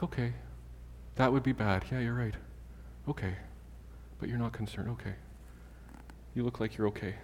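soft, subdued acceptance; reassured child. Acted. Child accepting calm parent's answer, quietly reassured.

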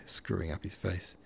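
usual speaking voice

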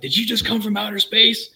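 high-pitched, incredulous retold freak-out; freaked-out amazement. Stunned stranger to airplane-car driver, freaking out. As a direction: imitate a man losing it in disbelief. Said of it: "he's freaking out".

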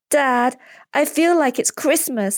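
put-on high, drawn-out child's voice; slow, high-pitched boyish wonder; excited boy. A narrator's reading. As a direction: play an eager kid talking to Dad. Excited boy talking to his dad.

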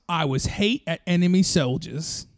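usual speaking voice

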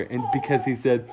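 plain reading voice

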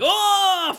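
high, loud "oh" of someone sent flying; hammed-up yell as if knocked flat; drawn-out cartoonish high yelp; mock cry of impact. Acting Michael being knocked flying.

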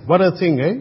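ordinary talk, nothing marked

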